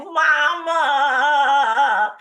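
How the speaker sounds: high, loud, long mock wail; crying out. Daughter seeing mother walk in, wailing. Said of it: "I'm just crying and crying".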